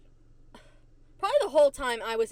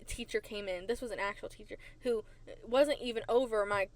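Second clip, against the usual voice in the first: ordinary talk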